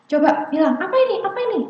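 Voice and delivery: high, rapid-fire nagging questions; pushy parent. Acted. Parent forcing a small child to speak, nagging. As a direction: pester a child to say it. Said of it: "memaksa anak bicara".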